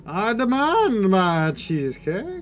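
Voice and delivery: put-on slow, high, loud drunk old man; drunken old man. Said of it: "she does not talk like a drunken old man".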